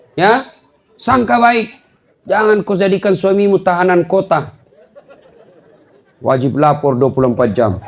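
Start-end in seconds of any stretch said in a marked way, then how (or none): none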